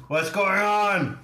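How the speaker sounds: high, slow call. Retold or imitated. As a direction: call out to him. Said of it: "you go like".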